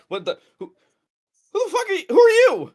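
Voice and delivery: high, loud startled yell; startled shout. Acted. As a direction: shriek it in surprise.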